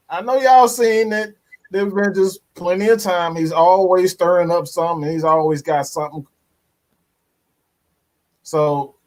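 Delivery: ordinary talk, nothing marked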